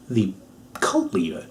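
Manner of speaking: whisper. Acted.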